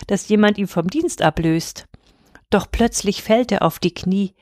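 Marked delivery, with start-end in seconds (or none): none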